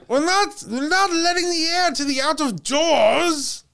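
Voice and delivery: high, put-on Patrick accent scolding. Dad yelling about open windows, in a Patrick accent. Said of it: "That was a Patrick accent".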